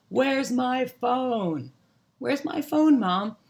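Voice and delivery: high, loud whining call to a parent; child calling Mom. Acted. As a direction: call out for Mom, stretching the words. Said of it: "the question you hear all the time".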